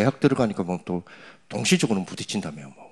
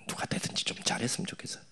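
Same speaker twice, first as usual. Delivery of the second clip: soft unvoiced aside; whispered aside; 11 dB quieter than usual. Real, not acted. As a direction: whisper it half to yourself. Quiet political aside. (혼잣말처럼 속삭이는 말투)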